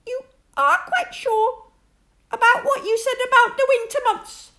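high squeaky voice, worried and pleading; small frightened pig checking; anxious Piglet. Acted. Piglet anxiously asks Rabbit, high and worried.

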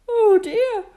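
high, loud whimpering bear; sniffly moaning. Acted. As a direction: moan it like a poorly cub.

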